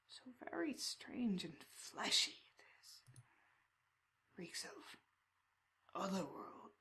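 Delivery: slow whispered rasp, a chained crow man toying with the intruder; slow raspy creature. Acted. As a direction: purr the words creepily and slowly. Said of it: "speaks with a slow, raspy cadence".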